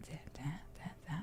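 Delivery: whispered practice. Retold or imitated. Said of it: "walking around my house sometimes being like".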